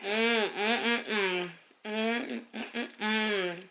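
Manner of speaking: humming while chewing; mouth full. Real, not acted. Eating a cookie.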